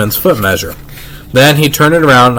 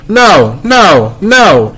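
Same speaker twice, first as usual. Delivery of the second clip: hollering; 7 semitones higher, much slower than usual. Acted. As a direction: holler each no like a stubborn little kid. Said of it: "he hollered".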